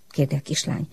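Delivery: usual speaking voice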